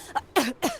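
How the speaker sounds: raspy coughing fit. Acted. Coughing while a friend urges him to breathe. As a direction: cough hard, struggling for air.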